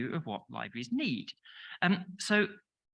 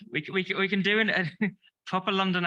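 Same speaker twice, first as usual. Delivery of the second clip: laughing; 5 semitones higher, 4 dB louder, faster than usual. Real, not acted. Laughing at audience heckle.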